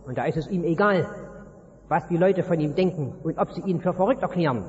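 ordinary delivery

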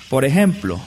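usual speaking voice

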